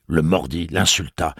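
plain reading voice

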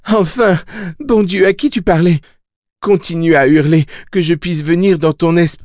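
high, urgent calling voice; exclamation pressante. A narrator's reading. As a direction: push it out high and hard, cut off mid-word. Jake shouts urgently, cut off. (voix aiguë et pressante, coupée net)

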